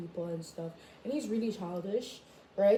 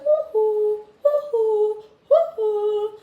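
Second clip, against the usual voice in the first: high loud repeated woohoo; teasing woohoo; 12 semitones higher, 11 dB louder, much slower than usual. Retold or imitated. Taunting the boy, high loud woohoo chant. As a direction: chant it like a cheering crowd.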